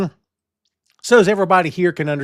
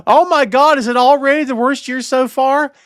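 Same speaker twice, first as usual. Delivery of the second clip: higher, louder mock dismay; mock shock; 10 semitones higher, 5 dB louder than usual. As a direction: feign big surprise.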